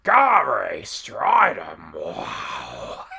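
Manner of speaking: loud, very slow gushing bellow, sinking into a low wow; over-the-top smitten commentator; Vince McMahon impression. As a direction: savour every syllable, hammed up. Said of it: "homosexual tendencies of Vince McMahon".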